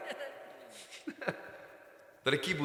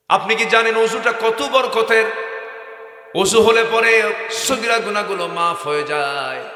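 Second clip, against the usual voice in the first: loud, high, impassioned sermon voice; full-force preaching; 6 semitones higher, 6 dB louder than usual. Acted. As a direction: preach it out with fervour to the crowd.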